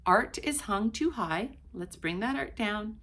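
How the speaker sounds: usual speaking voice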